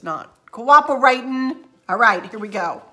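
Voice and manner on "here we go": usual speaking voice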